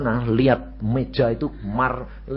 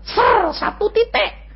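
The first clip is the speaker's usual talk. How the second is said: imitated light whoosh; 5 dB louder, slower than usual. Shown as a demonstration. Sound of light ray.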